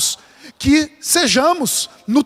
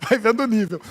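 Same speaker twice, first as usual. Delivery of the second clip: softer and faster, laughing through it; amused, fast, softer laugh-through repeat; 5 dB quieter, faster than usual. Real, not acted. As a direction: chuckle the line as it slips out. Preacher repeats the joke line.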